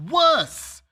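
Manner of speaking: high, loud single-word cry; sudden loud emphasis on worse; shouted word. Real, not acted. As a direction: hit worse hard with a loud rising cry.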